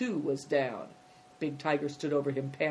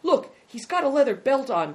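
high, louder exclamation; excited cry; 9 semitones higher, 6 dB louder than usual. Acted. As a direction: cry it out as an urgent discovery. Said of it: "cried Big Tiger".

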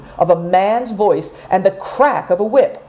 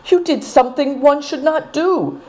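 put-on higher girlish voice, a touch reproachful; young girl's voice; 9 semitones higher than usual. A narrator's reading. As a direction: scold him gently in a young girl's voice. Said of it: "said Seven Stars".

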